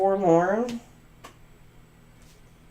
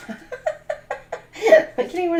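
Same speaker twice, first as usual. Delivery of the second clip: laughing; 11 semitones higher, 4 dB louder, much slower than usual. Real, not acted. Laughs at her own joke.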